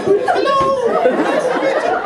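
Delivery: very high, loud mock Irish greeting; high-pitched Irish Hello to a tiny man; mock Irish accent. Greeting the tiny finger man in a high mock Irish accent. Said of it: "so you raise your".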